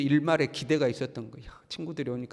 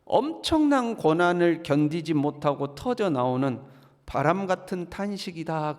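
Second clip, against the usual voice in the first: ordinary delivery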